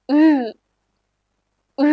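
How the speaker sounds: "ഉ" said as U and E merged, tightly rounded; blended U-E vowel. Shown as a demonstration. Teacher giving learners the U-umlaut trick, "ഉ" as blended U-E. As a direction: hold "ഉ" as one fronted U-E blend. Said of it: "യു ഇ ഒരുമിച്ച് പറയുമ്പോൾ".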